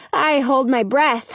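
plain reading voice

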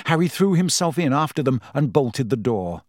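usual speaking voice

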